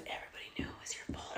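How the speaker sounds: hushed, voiceless aside; whispered. Real, not acted. Warning the writer about everybody knowing, whispered. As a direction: drop right into a whisper.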